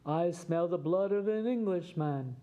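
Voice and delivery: lightly voiced giant, mock shout; a hint of a hungry giant's shout. A narrator's reading. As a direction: play the giant calling out, a mild storybook boom. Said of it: "shouted the giant".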